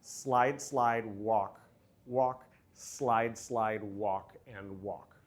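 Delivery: rhythmic step calling. Shown as a demonstration. Teacher calling slide slide walk walk to the dancers. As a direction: slide slide lifted, walk walk dropping. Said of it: "I'll tease that when I do a quick quick, I go".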